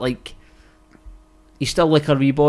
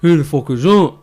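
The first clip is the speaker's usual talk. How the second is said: louder annoyed retort; 6 dB louder than usual. Retold or imitated. Colin answering the mystery voice, annoyed. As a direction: snap back at the unseen caller. Son startled by a hidden voice.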